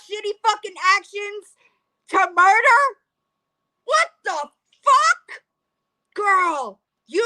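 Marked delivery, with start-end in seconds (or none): 2.1-5.4 high, loud disbelief; stunned and appalled, pitch shooting up; incredulous. Real, not acted. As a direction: react in outraged disbelief. Shocked by comparison to murder in video.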